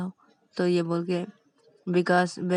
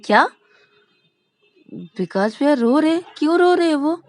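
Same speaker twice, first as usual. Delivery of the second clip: rising, higher and louder in alarm; shocked disbelief; 8 semitones higher, 8 dB louder, faster than usual. Acted. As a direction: blurt it out, stunned. Ravi shocked Vikas is crying. (चौंककर ऊँची आवाज़ में)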